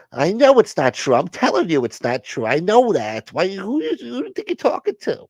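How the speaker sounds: hammed-up outrage at being doubted; indignant snap. High voice snapping in skit.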